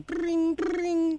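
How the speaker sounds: high mock ringing sound; ringing phone noise.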